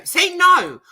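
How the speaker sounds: high, loud No with no R at all; demonstrating no. Shown as a demonstration. Insisting to the chat she never says no with an R. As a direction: hit the word no clean, no R. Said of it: "I don't say it with an R".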